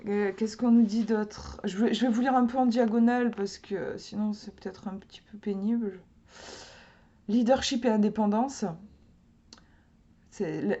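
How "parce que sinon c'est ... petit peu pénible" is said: breathy whisper to the viewers; whispered aside. Real, not acted. Telling viewers she will skim, in a whisper. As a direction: whisper it, a quick confiding aside. Aside about reading diagonally.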